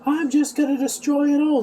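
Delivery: mock-angry, pitched high; retold anger. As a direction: imitate a man losing his temper in a high voice. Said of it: "he's getting mad".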